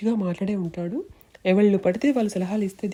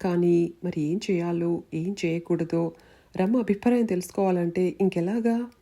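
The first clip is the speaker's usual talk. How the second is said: plain reading voice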